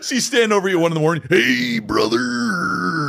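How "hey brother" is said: slow drawn-out wrestler voice; hammed-up wrestler promo; exaggerated cartoon intonation.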